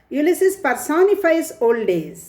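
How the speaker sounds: normal talking voice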